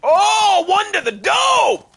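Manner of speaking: high, loud, drawn-out yell; slow triumphant battle yell; excited shout. Real, not acted. Sudden fight with wolves.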